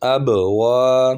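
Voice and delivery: "اب و" with a bouncing echo on b; slow "اب و", releasing the b before a long waa; qalqalah. Shown as a demonstration. Teacher demonstrating qalqalah, "اب و" with bounced b. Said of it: "قلقلہ کریں گے".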